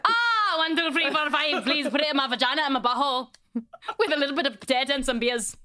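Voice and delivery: loud, high, garbled mock Irish; muddled Irish accent. Demonstrating accent for bedroom joke, loud muddled Irish. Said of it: "What is that accent?".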